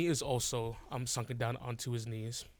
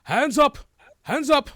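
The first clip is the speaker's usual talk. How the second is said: sharp high command yelled at the party; guards shouting; 14 semitones higher, 14 dB louder than usual. Acted. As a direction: bark the order loud and high. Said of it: "they just go".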